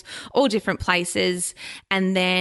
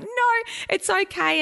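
high, light imitation of her husband comforting her; mock soothing husband; 13 semitones higher, slower than usual. Imitating her husband comforting her after workshops, light mock soothing. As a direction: lightly voice him saying it's fine. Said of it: "he's like".

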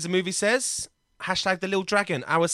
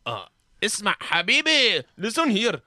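hammed-up Middle Eastern accent, climbing high; oily come-on in a broad mock Arabic accent; loud, high mock Middle Eastern accent; put-on foreign accent; 8 semitones higher, 4 dB louder than usual. Shown as a demonstration. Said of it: "let me do my accent montage".

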